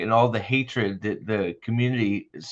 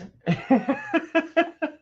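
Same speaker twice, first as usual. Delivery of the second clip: high squealing laugh. Real, not acted. Laughing fit.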